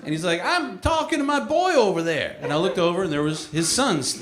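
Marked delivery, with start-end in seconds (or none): 0.4-2.4 high-pitched hillbilly voice; cartoon hillbilly. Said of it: "cartoon hillbilly".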